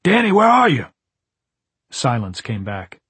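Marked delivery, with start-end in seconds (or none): 0.0-0.9 calling out. A narrator's reading. Calling into empty lobby.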